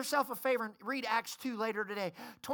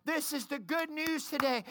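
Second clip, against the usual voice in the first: normal talking voice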